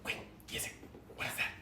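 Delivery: soft urgent whisper. Acted. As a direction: whisper his name.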